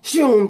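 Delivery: loud vocal whoosh; punchy sound-effect "shoom"; whooshing teleport sound. Retold or imitated. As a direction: imitate a burst of teleporting.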